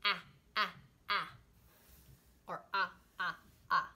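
clipped ah ah ah phonics sounds; letter sound ah. Shown as a demonstration. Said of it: "and it goes".

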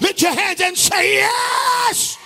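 rising sing-song shout; preaching at full force. Acted. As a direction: drive the congregation.

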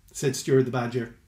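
ordinary delivery, nothing marked

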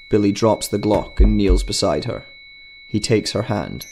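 ordinary delivery

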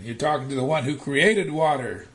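ordinary delivery

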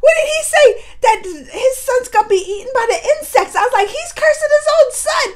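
high, loud, laughing disbelief; incredulous. Real, not acted. Vlogger recounting a father cursing his son, high-pitched and incredulous. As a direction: exclaim it as if scandalized, pitch way up.

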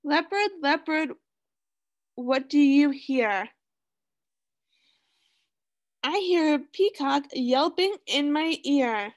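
sing-song storybook reading; reading to children. Acted. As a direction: read it like a picture book for kids. Reading picture book to class.

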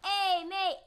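high, loud mock-Aussie hail; called-out challenge. Gamer hailing an attacker coming down, loud call. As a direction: shout it over at a rival. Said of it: "and be like".

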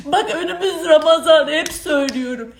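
usual speaking voice

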